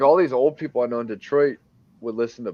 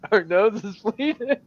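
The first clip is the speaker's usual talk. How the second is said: laughing; 11 semitones higher than usual. Pretend. Joking mockery with laughter.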